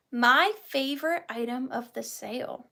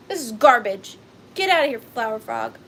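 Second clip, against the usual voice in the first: mock dismissive; 7 dB louder than usual. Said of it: "they would just be tossed out".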